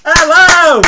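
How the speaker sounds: loud, high, delighted hello; excited greeting. Real, not acted. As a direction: greet a friend with a big burst of joy. Enthusiastic on-screen greeting.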